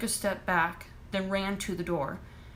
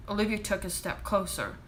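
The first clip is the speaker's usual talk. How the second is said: plain reading voice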